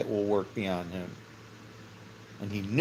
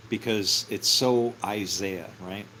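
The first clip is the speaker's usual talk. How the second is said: usual speaking voice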